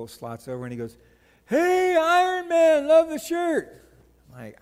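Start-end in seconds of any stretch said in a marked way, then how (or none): 1.5-3.8 high, drawn-out shout across a parking lot; calling out. Retold or imitated. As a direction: holler a friendly greeting from afar. Man calls out from a truck.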